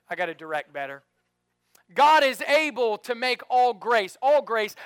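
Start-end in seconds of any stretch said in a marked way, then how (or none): 1.9-4.8 emphatic preaching. Shown as a demonstration. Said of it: "preaching emphatically".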